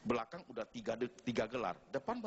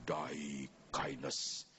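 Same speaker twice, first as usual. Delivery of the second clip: solemn greeting; 9 semitones lower, much slower than usual. Retold or imitated. Congregation greets the bishop.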